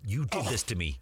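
mildly acted blame; mock reproach. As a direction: pretend son accusing his dad. Imagined son blaming his dad.

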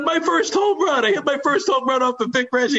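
imitating a legend's joyful relief; triumphant recall. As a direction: announce it like a found treasure. Aaron finally remembers, thrilled.

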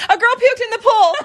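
high, loud, laughing confession; laughing blurt. Retold or imitated. Wife finally confessing the pool vomit to husband, laughing.

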